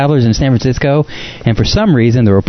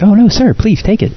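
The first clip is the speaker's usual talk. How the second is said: mock clerk; 10 semitones higher than usual. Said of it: "They're like".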